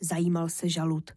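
plain reading voice

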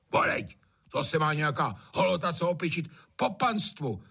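haughty, high-pitched grumble; indignant lordly agreement; scornful master. A narrator's reading. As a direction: scoff at riffraff aping their betters. Master scoffs, agreeing with servant.

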